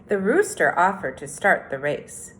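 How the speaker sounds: storybook read-aloud, unhurried and clear; reading to children. A narrator's reading. Teacher reading The Tortoise and the Hare to third graders, storytelling. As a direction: read it like story time on the rug.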